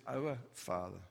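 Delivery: low, soft, reverent Our Father; reverent prayer. Retold or imitated. Jesus beginning the Lord's Prayer, low and reverent. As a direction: pray it quietly, with awe. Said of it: "But he says".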